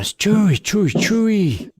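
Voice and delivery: a hammed-up, badly faked Scottish accent, drawn out; mock Scottish chant of a name, slowed; a clumsy attempt at Scottish. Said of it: "That is the worst fucking accent I've ever heard".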